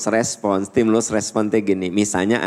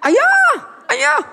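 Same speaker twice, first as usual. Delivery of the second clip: high, loud call across the floor; calling out loudly; 4 dB louder than usual. Retold or imitated. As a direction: yell for dad from afar. Calling husband in the mall.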